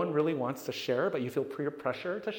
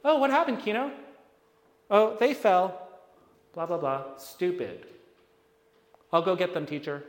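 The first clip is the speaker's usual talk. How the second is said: garbled teacher voice; 3 semitones higher, 3 dB louder than usual. Retold or imitated. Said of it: "So all he hears is".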